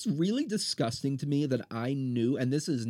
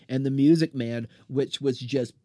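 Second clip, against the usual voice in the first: ordinary delivery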